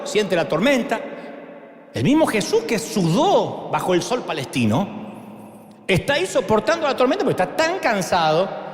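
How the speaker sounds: ordinary talk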